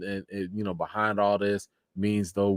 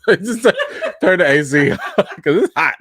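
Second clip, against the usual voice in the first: high laughing plea, louder; laughing request; 9 semitones higher, 9 dB louder than usual. Real, not acted. Host laughing, asking chat to turn up the AC.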